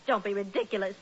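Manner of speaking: ordinary delivery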